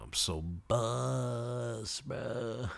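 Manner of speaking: slow, slurred mock-drunk drawl; fake drunk slur. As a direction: pretend to be wasted, dragging every word out. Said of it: "I can't even talk right now".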